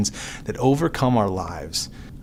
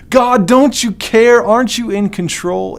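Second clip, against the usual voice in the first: much higher, louder, slow cry to God; desperate yell; 10 semitones higher, 7 dB louder than usual. Acted. Believer yelling at God in the storm, desperate. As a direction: yell it up at heaven in panic. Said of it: "Yelling his name".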